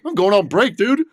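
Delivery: high, loud, elated announcement; giddy. Retold or imitated. Telling the manager he's off on break, high and elated. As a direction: fling it out high with pure glee. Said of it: "and I was like".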